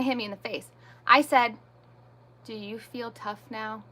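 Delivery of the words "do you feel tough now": much softer, controlled and cool; retold calm challenge. Mother facing the screaming husband, quiet calm challenge. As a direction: drop the volume and challenge him calmly. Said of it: "how you stayed so calm".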